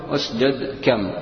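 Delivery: اسجد كم with the final letters stopped silent, slowly; stopped with sukun. Shown as a demonstration. Teacher demonstrating a pausal form, stopped silent ending. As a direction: say اسجد كم ending each word on a bare consonant. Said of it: "وتنطقها في الوقف".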